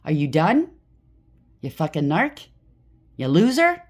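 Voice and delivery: loud mocking retort, peaking high and loud on loser; snapping back at the narc she dealt with; retold comeback. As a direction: fire the insults back at him. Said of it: "I gotta give it right back to him".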